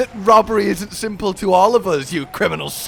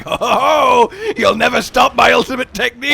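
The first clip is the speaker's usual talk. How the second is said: gloating villain; 5 semitones higher, 6 dB louder than usual. Pretend.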